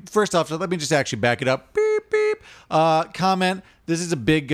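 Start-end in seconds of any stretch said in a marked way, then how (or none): 1.7-2.4 very high, even reversing beeps; backing-up beeper. Retold or imitated. Podcaster backing up his story, high mock reversing beeps. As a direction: imitate a truck's reverse alarm in a high pitch.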